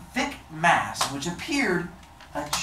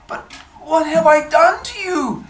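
high, pleading fisherman; 12 semitones higher, 5 dB louder than usual. Acted. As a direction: ask high and desperate. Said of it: "asked the fisherman".

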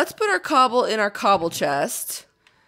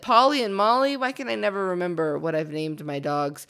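usual speaking voice

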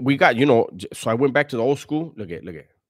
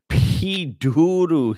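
laughing mock name; 6 semitones higher, 5 dB louder, much slower than usual. Real, not acted. Mocking a chatter's joke name.

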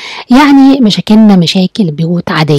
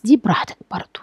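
whisper; 6 dB quieter than usual. Real, not acted. Aside on free choice.

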